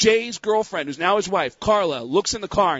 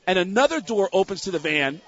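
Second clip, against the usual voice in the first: ordinary delivery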